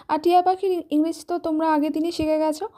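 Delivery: ordinary talk, nothing marked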